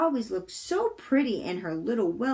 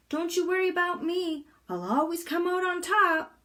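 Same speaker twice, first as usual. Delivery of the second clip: higher, cocky reassurance; confident Pippi; 8 semitones higher than usual. Acted. Pippi reassuring the sailors she'll be fine, higher and cocky. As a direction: tell them not to worry, chin up. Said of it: "she said".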